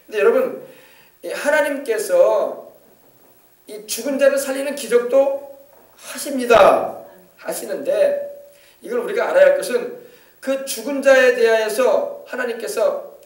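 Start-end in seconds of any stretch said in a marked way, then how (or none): none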